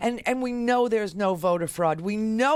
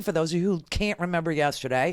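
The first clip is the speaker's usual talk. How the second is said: ordinary talk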